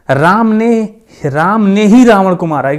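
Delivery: ही पर ज़ोर देकर, ऊँचा और तेज़; राम ने ही रावण को मारा — "ही" को ठोककर बोलो; stress on ही. Shown as a demonstration. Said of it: "यानी जोर दे रहा है वो". ("ही" शब्द पर पूरा ज़ोर डालकर कहें)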